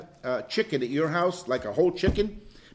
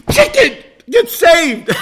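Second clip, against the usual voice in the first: much louder and higher, slow and grand; mock preaching at a chicken; 11 semitones higher, 16 dB louder, slower than usual. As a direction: command the chicken to be saved. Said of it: "talk to it".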